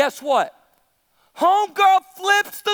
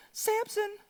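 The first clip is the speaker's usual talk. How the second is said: high, soft put-on coaxing voice; Delilah; 5 semitones higher, 11 dB quieter than usual. Preacher plays Delilah calling Samson, high and soft. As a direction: call his name softly, up high. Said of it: "So Delilah said to Samson".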